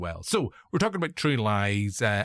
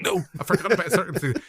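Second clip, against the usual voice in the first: higher mock-indignant denial through a laugh; amused, raised-voice retort; laughing protest; 6 semitones higher, 4 dB louder than usual. Retold protest to wife, laughing.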